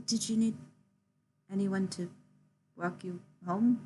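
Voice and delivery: quietly collected, gently paced question; composed after stumbling. Acted. Character offering to walk her home, quietly composed. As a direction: offer it carefully, with small pauses. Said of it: "they quickly compose themselves".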